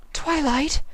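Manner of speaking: delicate hazy voice; Rarity, dazed. A narrator's reading.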